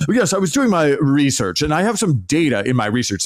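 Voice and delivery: hammed-up pretend-professor voice; mock pseudo-academic. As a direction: send up a cosplaying professor one-upping colleagues. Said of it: "They talk about their research all the time".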